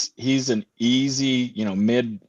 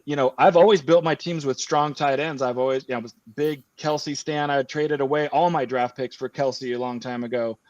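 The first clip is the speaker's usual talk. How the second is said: ordinary talk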